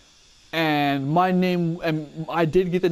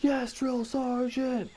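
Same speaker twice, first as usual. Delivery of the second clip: barked military recruit reply; 10 semitones higher, 6 dB quieter, slower than usual. Retold or imitated. Recruit answering drill sergeant.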